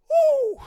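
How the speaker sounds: high, loud party whoop; celebratory whoop. Real, not acted. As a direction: let out a victory whoop. Celebrating chugging a drink.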